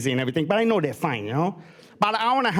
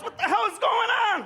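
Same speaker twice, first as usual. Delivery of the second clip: mock shout; 13 semitones higher than usual. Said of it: "She goes".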